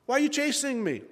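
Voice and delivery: high, insistent question; pleading. Acted. David one-on-one with the king, pleading. David's plea to Saul, repeated.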